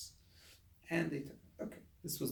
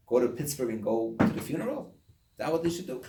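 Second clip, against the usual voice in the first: ordinary delivery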